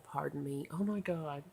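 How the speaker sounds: ordinary talk, nothing marked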